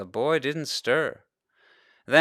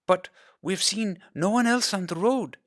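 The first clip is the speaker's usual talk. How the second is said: put-on young girl voice; 10 semitones higher than usual. A narrator's reading. Girl answers running off.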